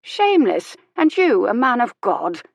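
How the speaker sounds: high, loud mock outrage; mock scolding. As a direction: scold him playfully, pitch up high. Old lady mock-scolding the vicar.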